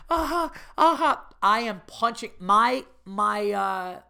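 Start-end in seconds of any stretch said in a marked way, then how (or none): 0.1-1.3 laughing hard. Retold or imitated. Friend howling with laughter.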